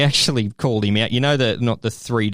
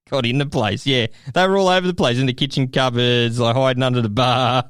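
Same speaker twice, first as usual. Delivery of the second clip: usual speaking voice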